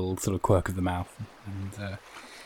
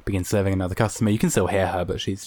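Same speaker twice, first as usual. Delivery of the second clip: ordinary talk, nothing marked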